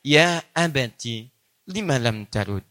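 tender plea. Shown as a demonstration. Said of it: "Ini yang indah".

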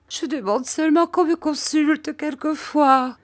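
high, trembling tearful voice; weeping wife begs not to be ruled; weeping plea. A narrator's reading. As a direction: beg through sobs, voice up high. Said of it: "qui pleurait à chaudes larmes".

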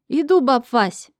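loud, slow, annoyed call; grudging shout. Acted. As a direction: yell back reluctantly. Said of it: "недовольно скривиться и крикнуть". (недовольный крик)

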